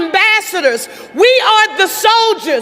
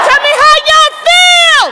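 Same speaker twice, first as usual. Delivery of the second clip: much higher and louder, shouted to the crowd; full-voice rally call; 7 semitones higher, 5 dB louder than usual. Real, not acted. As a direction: let it ring out to the whole arena. Rally call after whispered practice.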